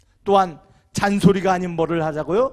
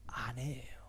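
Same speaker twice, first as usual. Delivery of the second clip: a hushed reveal of 아내예요; whispered answer; 8 semitones lower, 18 dB quieter, slower than usual. Real, not acted. Whispered answer to own question.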